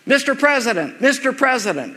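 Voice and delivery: mock reporter calling out, pitched well up. Someone in the crowd calls out to Jesus, mock shouted. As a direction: shout it up from the crowd to get noticed. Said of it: "Someone's shouting out a question".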